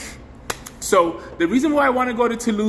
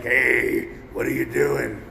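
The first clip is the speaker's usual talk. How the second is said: lower, slightly odd alien voice; lightly voiced alien; 6 semitones lower, slower than usual. Vlogger voicing the alien filter creature, low and odd. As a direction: voice the alien on my shoulder. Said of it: "it's an alien that's like".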